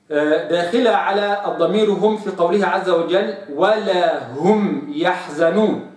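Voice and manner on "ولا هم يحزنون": ولا هم يحزنون said slowly; slow, clear ولا هم يحزنون; careful recitation. Shown as a demonstration. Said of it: "لا أقرأها". (تلاوة متأنية)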